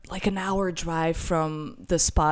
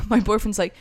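normal talking voice

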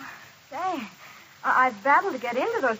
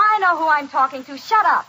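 loud, indignant retort; angry outburst; 6 dB louder than usual. Acted. Waitress rounding on her boss, angrily. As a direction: cut them off with a loud, angry order. Quarrel with boss in front of guest.

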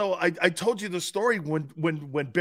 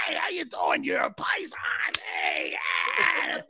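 put-on Italian accent; 11 semitones lower, slower than usual. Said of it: "little tiny Italian guy".

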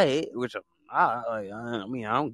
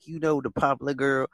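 ordinary talk

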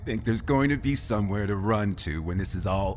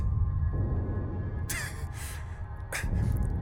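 high, breathy gleeful cackle; high villain cackle; 4 dB quieter than usual. Acted. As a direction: laugh at their doom.

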